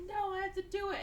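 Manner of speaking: high imitated protest; mock insistence. Junior pilot fights captain for the controls, mock insistence.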